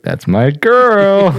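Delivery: high, loud, slow proud exclamation. Retold or imitated. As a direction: drawl it out like a proud dad. Proud dad aside to wife.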